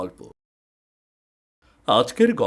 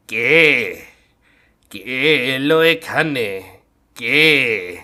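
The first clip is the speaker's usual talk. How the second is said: eerie voice; 7 dB louder, much slower than usual. Acted. Eerie voice in dark house.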